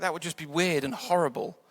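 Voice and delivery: ordinary talk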